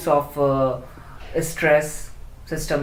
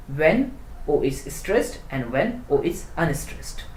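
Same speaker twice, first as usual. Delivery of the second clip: usual speaking voice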